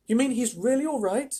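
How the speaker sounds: high, astonished question; astonished boy. A narrator's reading. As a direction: ask it high, amazed at the news. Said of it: "asked Charlie, astonished".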